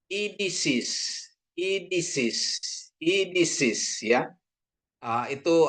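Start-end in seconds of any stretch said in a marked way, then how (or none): 0.1-4.3 Idisis said higher and slow, I at the start; repeated demo reading. Shown as a demonstration. Teacher drills idisis for the class. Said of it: "jadi bacanya I".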